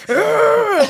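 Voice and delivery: high, drawn-out mock groan; loud, high imitation of a child's fed-up groan; exasperated "ugh". Mother imitates her worked-up daughter, hammed-up exasperated groan. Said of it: "She be like".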